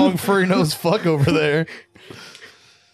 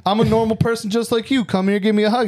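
higher, sweet fake-friendly voice; a monster coaxing you to come close, high and sugary; high fake-friendly lure; mock monster pretending human; 6 semitones higher, faster than usual. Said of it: "And then it's like".

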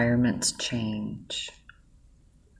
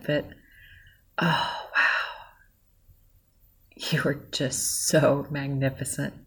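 getting emotional; 3 semitones higher than usual. Real, not acted. Moved to tears while thanking the listener, emotional. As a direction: let the gratitude overwhelm you, voice lifting as you tear up. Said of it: "I'm getting emotional".

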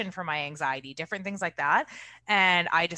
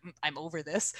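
weary; 8 dB quieter than usual. Retold or imitated. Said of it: "I'm like".

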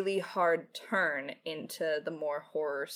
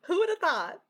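high, loud gushing squeal, much higher than her own voice; excited squeal; 12 semitones higher, 10 dB louder than usual. Retold or imitated. Writer gushing to her family about fan art, in a high, loud squeal. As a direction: squeal it out like you can't believe your luck. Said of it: "being like".

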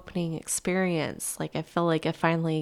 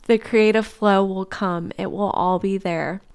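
gentle, reassuring read of a letter; reading a tender letter; 5 dB louder than usual. Acted. Reading love's reply to her tired self, gently reassuring. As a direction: read it like love comforting you.

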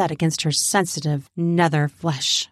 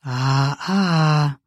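low teasing reproof; 5 semitones lower than usual. Acted. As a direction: chide playfully in a lower voice. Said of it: "I teased".

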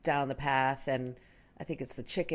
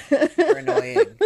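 high, loud, slow drawn-out annoyance; sweet then stretched-out complaint; mock exasperation; 13 dB louder, much slower than usual. Telling somebody she loves them but they're annoying, slow high whine. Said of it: "I'm just like".

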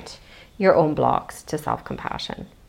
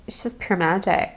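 usual speaking voice

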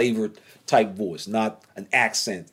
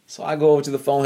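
usual speaking voice

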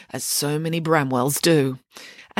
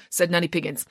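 usual speaking voice

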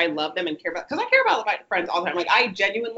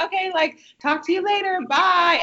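awkward brush-off; 10 semitones higher, 3 dB louder than usual. Retold or imitated. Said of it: "'Cause I'd be like".

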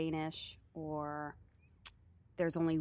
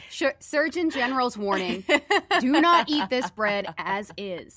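mock official warning; 3 semitones higher, 6 dB louder than usual. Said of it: "warning label like they have on cigarettes".

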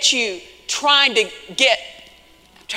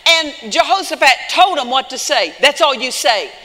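loud, raised-pitch preaching; 5 semitones higher, 6 dB louder than usual. Real, not acted. Preacher telling the congregation what to say in praise, forceful preaching. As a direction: punch it out to the congregation.

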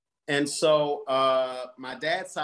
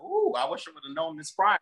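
high gossipy delight; gleeful ooh; 14 semitones higher than usual. Retold or imitated. Guest hearing the aunts' history, high gleeful ooh. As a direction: relish the juicy news. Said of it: "And I was like".

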